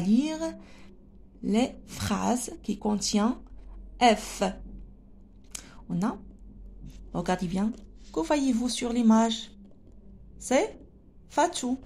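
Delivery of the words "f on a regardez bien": ordinary talk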